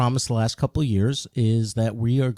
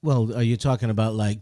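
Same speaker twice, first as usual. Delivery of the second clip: normal talking voice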